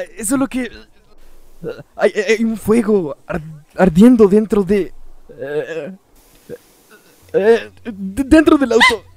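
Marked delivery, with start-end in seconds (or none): 2.0-9.0 low, halting stammer; hesitant stammer. Acted. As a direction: stumble and search for each word. Hesitantly reports fire in car.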